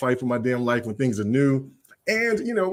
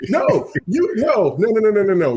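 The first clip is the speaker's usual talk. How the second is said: higher and louder rapid noes; excited protest; 8 semitones higher, 4 dB louder than usual. Real, not acted. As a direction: protest in a rush, pitch high. Protesting loudly, high.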